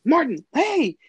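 high, loud excited call across the stairs. Retold or imitated. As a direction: shout a friend's name as you spot him. Spotting friend on stairs, calling.

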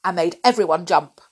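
ordinary delivery

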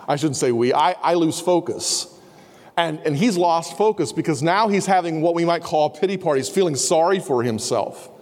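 normal talking voice

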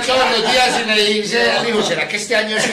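pleading son. Acted. Son begging queen daily.

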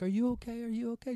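put-on high, anxious voice asking a fallen child; urgently checking on the hurt girl; Megan concerned. Megan asks the crying girl if she's okay, high and worried.

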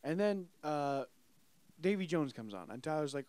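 normal talking voice

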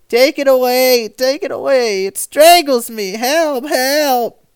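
high, loud, panicked screaming; a dying man screaming for help; terrified cries. A narrator's reading. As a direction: shriek it out in mortal terror. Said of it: "he cried, waving his arms as if a prey to the most awful terror".

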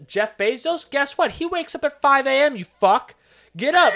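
loud, high mocking fitness guru; loud hustle guru. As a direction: yell it like a pushy influencer. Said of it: "This is what Warren Buffett does".

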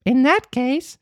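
high puppet voice, proud. Acted. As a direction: say it with defiant pride. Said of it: "said Pinocchio proudly".

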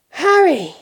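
loud, high call, a touch breathless; relieved, puffed cry; panted. A narrator's reading. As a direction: call out as if just run up. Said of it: "Mister Weasley panted".

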